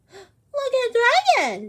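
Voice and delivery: mock Dragon in a squeaky voice; high jeering animal voices; mocking animal crowd. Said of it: "they cried".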